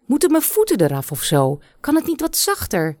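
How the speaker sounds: hoge, verontwaardigde uitroep van de moeder; klagende moeder. Acted. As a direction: klaag overdreven alsof het pijn doet. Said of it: "riep ze". (moeder klaagt luid bij haar dochter over de massage, hoog en verontwaardigd)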